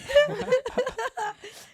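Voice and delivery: loud wheezing laugh; high cackling laugh. Real, not acted. Cracking up.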